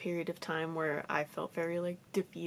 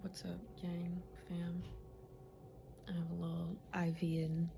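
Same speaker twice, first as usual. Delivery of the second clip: whispering; 9 dB quieter than usual. Real, not acted. Pre-op bed, hushed voice.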